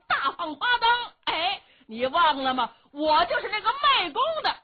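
loud, high, swaggering brag; boastful taunt. Acted. Xiong Kuohai reminding Yuwen Chengdu of the lantern fair, loud bragging. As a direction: crow over your old rival.

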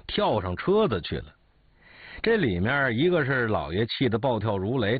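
ordinary delivery

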